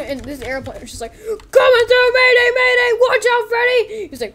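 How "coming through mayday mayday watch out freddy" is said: very high, loud frantic calls; mayday shout. Acted. Airplane warning Freddy, high shouted alarm. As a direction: yell like a crashing pilot.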